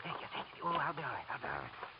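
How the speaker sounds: breathy, fading, barely voiced; weak and faint. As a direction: fade away weakly. Professor collapsed on couch.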